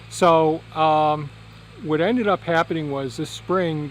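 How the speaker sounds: normal talking voice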